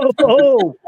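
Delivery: high startled gasping oh. Retold or imitated. Narrator startled by the ghost's answer, high yelp.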